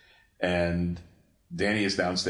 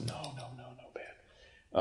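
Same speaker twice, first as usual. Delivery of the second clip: hushed whisper, much softer; whispered aside; 6 semitones higher, 13 dB quieter, slower than usual. Real, not acted. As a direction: whisper your disapproval under your breath. Mock-secret verdict on a show.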